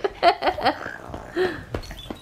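helpless giggling under tickles; being tickled. Real, not acted. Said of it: "Michael just tickling him".

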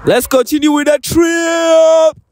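slow, drawn-out call; hammy rallying shout. Real, not acted. Cheerfully calling the group on.

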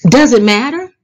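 ordinary delivery, nothing marked

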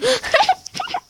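high-pitched burst of laughter; sudden high cackle; laughing. Real, not acted. Player cracking up at own game, high laugh.

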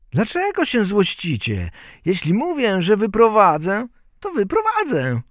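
put-on high, insistent child voice; Bździelik. A narrator's reading. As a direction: insist loudly in a tiny boy's voice. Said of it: "Bździelik, jakoś dopędził złodziei". (wysoki, stanowczy głosik malca)

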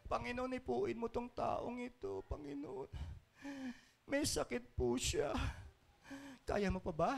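very soft, feeble mock praying; lifeless prayer. Preacher mimicking a servant praying over the sick, lifeless and feeble. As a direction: let the prayer sag, hesitant and doubtful. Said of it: "Walang kabuhay-buhay ang mga panalangin".

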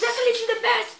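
loud, high, excited shout; thrilled exclamation. Real, not acted. Boy thrilled by friend's card pull, excited shout.

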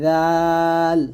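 drawn-out thin "রাল", tongue on upper teeth edge; thin light letter. Shown as a demonstration. Teacher contrasting two letters for students, long thin "রাল". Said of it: "পাতলা".

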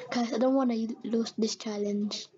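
ordinary talk, nothing marked

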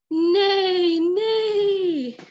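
upset toy horse; crying neigh. Acted. Horse cries out distressed.

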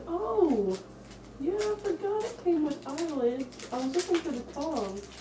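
muffled inside full head mask; through a fursuit head. Real, not acted. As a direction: voice behind costume head. Seen 3: Fursuit head worn throughout, bowed down, then face shown at 9.6s.

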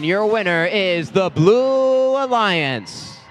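slow, stretched-out announcer reveal; winner announcement. Acted. Announcer revealing the winning alliance to the crowd, drawn-out hype call. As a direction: swell and draw out the winning name.

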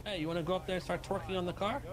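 ordinary delivery

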